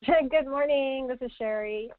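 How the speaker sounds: normal talking voice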